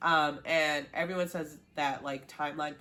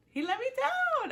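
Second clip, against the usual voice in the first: much higher, louder, wailing mock heartbreak; hammed-up wail; 4 dB louder than usual. Shown as a demonstration. Fan wails about Dom letting her down. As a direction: whine it like a betrayed fan.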